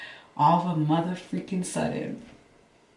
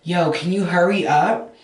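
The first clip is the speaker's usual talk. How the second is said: almost snapping; 2 semitones higher, 8 dB louder than usual. Retold or imitated. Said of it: "I almost snapped".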